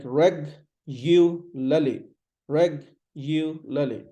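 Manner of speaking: reg-u-lally broken into slow parts, REG, yoo, luh-lee; "reg-u-lally" spelt out by sound; syllable-by-syllable regularly. Shown as a demonstration. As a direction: say reg-u-lally slowly with pauses between parts. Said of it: "So it's like reg-u-lally".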